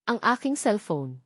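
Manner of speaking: usual speaking voice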